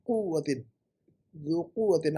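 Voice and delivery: कुव्वतिन with "u" and doubled w; corrected vowel. Shown as a demonstration. Teacher gives the correction, soft कुव्वतिन starting "qu". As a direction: say कुव्वतिन as "quw-watin", softly. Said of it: "क़वातिन नहीं ना".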